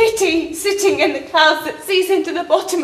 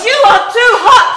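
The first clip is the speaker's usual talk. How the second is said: protesting shout; 5 semitones higher, 5 dB louder than usual. Acted. Mother protests father's rage.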